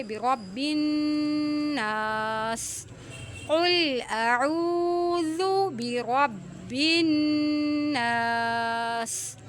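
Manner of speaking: slow, melodic tajweed chanting; Quran recitation. Shown as a demonstration. Teacher reciting to students, slow chanted recitation. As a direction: recite slowly with a drawn-out melody.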